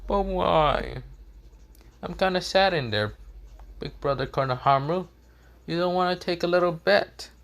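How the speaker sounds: put-on high-pitched, pleading kid; Boruto. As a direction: plead in a high, sad little voice. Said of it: "Boruto goes".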